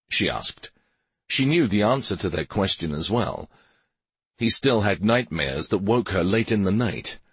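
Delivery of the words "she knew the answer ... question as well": plain reading voice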